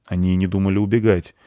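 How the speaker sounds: ordinary delivery, nothing marked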